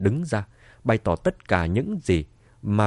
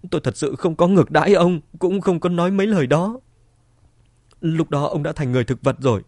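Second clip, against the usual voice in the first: raised, pleading voice; frantic denial; 5 semitones higher, 4 dB louder than usual. A narrator's reading. Wife persuades husband he only dreamed it, frantic denial. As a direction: insist desperately, pitched high. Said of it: "liên tục lắc đầu nói".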